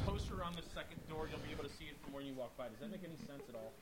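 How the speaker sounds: distant phone voice; speaking off mic; far from mic. Real, not acted. Talking into the phone away.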